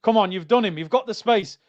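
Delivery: ordinary talk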